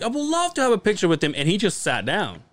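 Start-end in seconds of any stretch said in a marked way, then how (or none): 0.0-1.3 eager friend. Retold or imitated. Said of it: "he's like".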